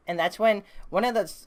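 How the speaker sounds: ordinary delivery, nothing marked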